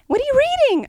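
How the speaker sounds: very high, chirpy mock question; high-pitched busybody impression; nosy seatmate. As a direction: imitate an overfriendly stranger butting in. Imitating the chatty seatmate.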